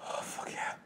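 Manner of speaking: whispered oh fuck yeah; hushed glee at the find; delighted whisper. Retold or imitated. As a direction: friend finds the cans in the drawer, gleeful whisper. Finds hidden drinks, delighted.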